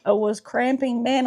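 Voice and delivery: ordinary talk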